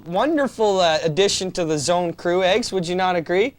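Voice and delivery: normal talking voice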